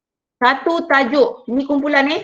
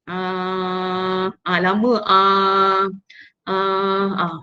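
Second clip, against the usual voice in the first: hesitant filler demo; 4 semitones lower, much slower than usual. Shown as a demonstration. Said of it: "markah kefasihan tu kurang lah".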